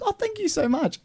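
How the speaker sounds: high, gushing mock thanks; imitating Tamara. Retelling Tamara at the cafe, high gushing thanks. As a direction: overdo the grateful thanks. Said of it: "And Tamara goes".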